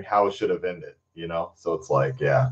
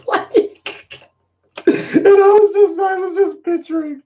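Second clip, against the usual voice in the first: words squeezed out through high laughter; real laughter breaking the words; laughing; 4 dB louder than usual. Real, not acted. Streamer recalls the comment to viewers, laughing hard. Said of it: "I was laughing".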